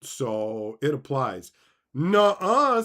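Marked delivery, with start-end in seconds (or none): 1.9-2.8 judge's retort. Retold or imitated. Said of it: "says the judge".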